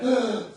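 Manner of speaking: sharp gasp, then a loud ugh; startled gasp. Retold or imitated. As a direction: relive the shock in one breath. Gasping on seeing the light.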